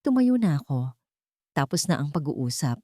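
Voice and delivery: usual speaking voice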